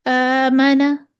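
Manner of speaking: "आमना" with a long, thin, light first vowel; बारीक अलिफ. Shown as a demonstration. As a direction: stretch a light, fronted alif in "आमना". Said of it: "बारीक पढ़ेंगे". ("आमना" का अलिफ बारीक, खींचकर)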